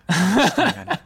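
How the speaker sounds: laughing through the words; giggling at own slip. Real, not acted.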